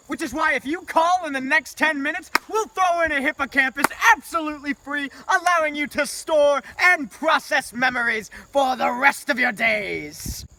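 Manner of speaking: loud, high pitchman push; infomercial. Acted. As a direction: sell it like a late-night ad. Infomercial call-to-action pitch.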